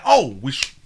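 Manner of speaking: normal talking voice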